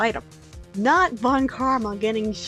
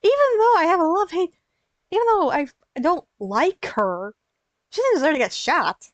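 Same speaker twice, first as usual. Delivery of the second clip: upset outburst; 8 semitones higher, 4 dB louder than usual. Real, not acted. Upset at shooting.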